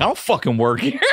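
indignant retort. Retold or imitated. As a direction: protest being mistaken for the waiter. Diner mistaken for waiter snaps back.

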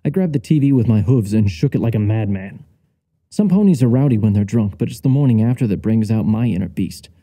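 usual speaking voice

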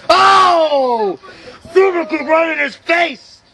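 high, drawn-out cry, then the call; excited shout. Real, not acted. Commentator calling a kick to the face, excited shout. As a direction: erupt as the kick connects.

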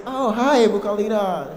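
high, loud mock-delighted hello; hammed-up excited welcome; gushing girly greeting. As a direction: greet a new girlfriend effusively. Women greet new friend gushingly.

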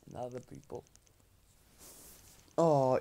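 usual speaking voice

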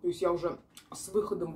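chewing while talking; speaking between bites; mouth full. Real, not acted. Seen 0: bites food at -1.0, jaw still working while speaking after.

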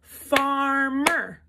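far-mer split in two beats; syllable clapping. Shown as a demonstration. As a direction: clap each half of farmer. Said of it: "So we're gonna clap farmer".

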